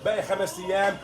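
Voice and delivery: hard sell ad. Acted. Reads shop promo for Thursday.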